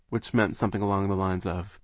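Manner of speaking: usual speaking voice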